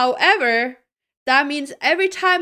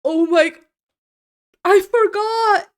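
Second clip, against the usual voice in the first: dismayed realization; 6 semitones higher, 8 dB louder than usual. Real, not acted. Streamer realizing the mistake, loud dismay.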